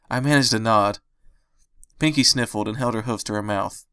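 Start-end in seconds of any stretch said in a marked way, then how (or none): none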